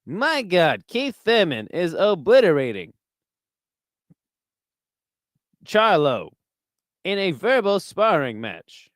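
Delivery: grandiose sportscaster with swooping inflections; halting ringside call of a dramatic knockout; Howard Cosell. Acted. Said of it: "And this is Howard Cosell".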